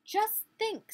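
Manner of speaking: proud bird showing off; robin. Acted. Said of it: "said the robin".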